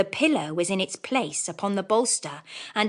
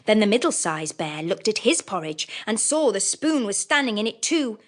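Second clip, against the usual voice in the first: ordinary delivery, nothing marked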